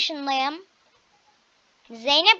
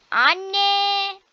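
loud call for mom; 7 semitones higher, 6 dB louder than usual. Pretend. Child calling loudly for mom in the game. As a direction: shout across the house for mom.

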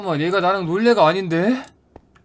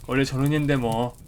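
normal talking voice

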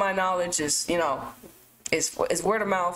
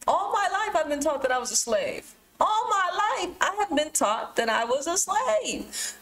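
rising fervor; 8 semitones higher than usual. Real, not acted. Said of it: "building emotional testimony".

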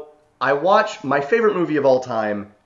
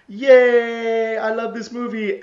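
gleeful squeal; 10 semitones higher, 4 dB louder, slower than usual. Retold or imitated. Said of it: "I was like".

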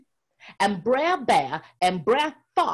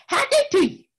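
high, loud, cheerful call; Brer Rabbit greeting; 11 semitones higher, 6 dB louder, faster than usual. Acted. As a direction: sing out the greeting to the crowd.

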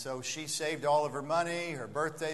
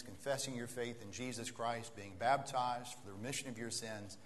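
normal talking voice

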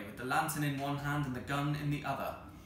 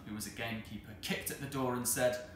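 usual speaking voice

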